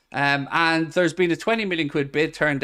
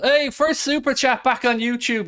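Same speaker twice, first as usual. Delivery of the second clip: high-pitched delighted call-out; excited cheer; 9 semitones higher than usual. Real, not acted. Streamer greets first super chat back on YouTube, excitedly. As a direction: celebrate it in a lifted, high voice.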